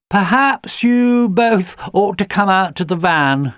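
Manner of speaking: a gentle, lightly voiced suggestion; Jenny's suggestion. A narrator's reading. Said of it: "suggested Jenny".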